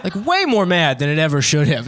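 ordinary delivery

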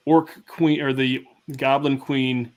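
normal talking voice

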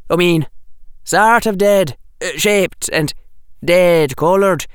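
emphatic schoolboy account with hesitations; Anthony. Acted. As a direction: explain haltingly, groping for the words.

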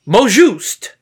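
put-on playful Cajun accent take. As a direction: say it with a Cajun lilt. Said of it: "Say it in a Cajun accent".

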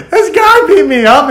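put-on boy melting down in his brother's van; retold whimper. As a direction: whimper it like a kid. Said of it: "melted like a little pussy".

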